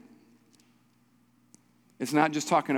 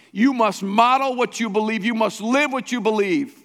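higher, forceful pulpit exhortation; emphatic preaching; 6 semitones higher, 5 dB louder than usual. Real, not acted. Pastor urging church to live its belief, emphatic preaching. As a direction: drive each command home.

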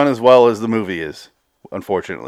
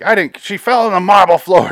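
high, loud protesting outburst; imitated yell; 10 semitones higher, 7 dB louder than usual. Brother denying he killed Mother, imitated yell. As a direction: yell it in indignant self-defense. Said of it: "He has a great yell".

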